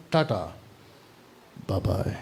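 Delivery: low, soft, casual farewell; offhand goodbye. Retold or imitated. Preacher voices Jesus dismissing departing disciples, low and offhand. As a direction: drop it quietly, as a mild dismissal. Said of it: "उसने कहा".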